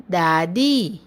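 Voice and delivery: दादी with both vowels stretched; drawn-out teaching demo of दादी; long vowels. Shown as a demonstration. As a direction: hold the final ee of दादी. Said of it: "शब्द का उच्चारण थोड़ा लंबा होता है".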